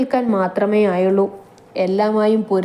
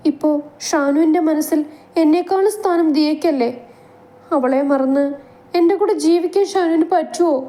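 jealous pressing; 7 semitones higher than usual. Acted. Naishu pressing Shan jealously.

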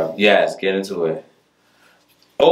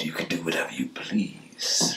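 whispering; 9 dB quieter than usual. Acted. Whispered vampire roleplay.

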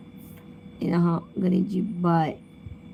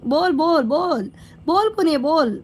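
teasing insistence; 6 semitones higher than usual. Acted. Teasing a viewer to speak.

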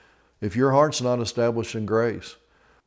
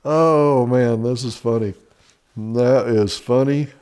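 real laughter; 4 dB louder than usual. Real, not acted. Laughing at joke list.

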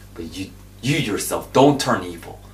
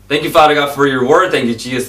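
fervent prayer; 4 semitones higher, 10 dB louder, faster than usual. Real, not acted.

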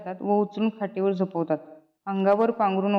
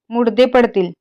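loud threat; 9 dB louder than usual. Acted. Thief threatens the household.

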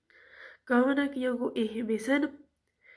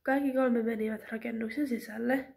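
ordinary delivery

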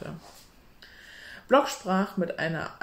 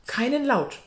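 commanding; 8 semitones higher, 6 dB louder than usual. Acted. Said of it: "befahl Peter".

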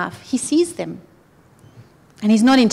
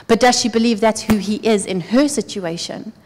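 ordinary delivery

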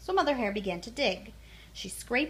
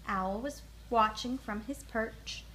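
plain reading voice

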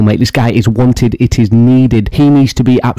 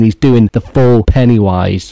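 ordinary talk

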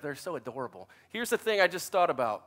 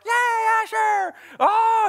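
very high squealed cheer; mock girlish cheer; 6 dB louder, slower than usual. Girls cheer their brother's catch, squealing excitedly.

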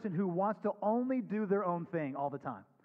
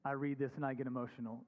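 a son admitting he is moved, softer; 5 semitones lower, 5 dB quieter than usual. Real, not acted. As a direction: let the words drop away quietly. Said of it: "As a son".

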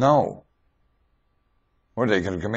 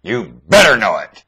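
shouted warning; 8 dB louder than usual. Real, not acted.

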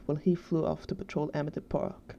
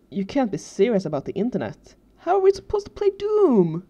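incredulous gamer protest, building in pitch and volume; dismayed outburst; 4 semitones higher, 6 dB louder than usual. A narrator's reading. Tucker to Danny on losing internet access, dismayed outburst. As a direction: rise to a high, loud wail by the end.

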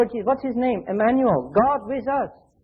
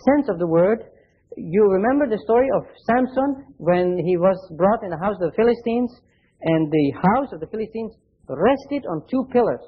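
ordinary talk

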